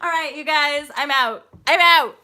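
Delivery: loud, cheery farewell to the viewers; loud sign-off. Real, not acted. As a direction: shout a happy goodbye.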